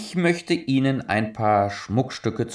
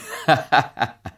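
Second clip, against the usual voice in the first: amused laugh; 3 semitones higher than usual. Acted. Laughs at the idea.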